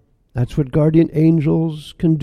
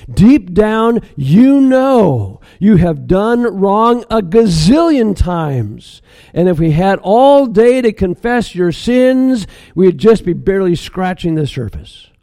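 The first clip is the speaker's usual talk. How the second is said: forceful stranger; 6 semitones higher, 6 dB louder than usual. Acted. Stranger confronting Mary with her sins.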